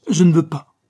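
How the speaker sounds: loud, high, flat refusal; a jealous man's loud refusal; angry refusal. A narrator's reading. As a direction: slam the door on the idea. Forbidding his lover's plan.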